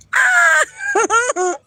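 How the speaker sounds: sudden loud, high burst of laughter; shrieking laugh. Real, not acted. Streamer cracking up at own riddle, shrieking laugh. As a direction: explode into a shrill laugh at your own joke.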